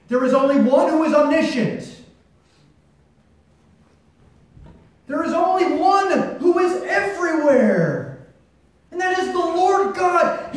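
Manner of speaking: building preaching. Acted. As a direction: build to a triumphant proclamation. Building proclamation of God's power.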